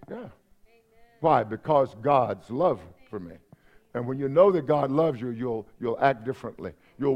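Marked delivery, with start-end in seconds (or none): none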